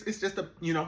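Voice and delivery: usual speaking voice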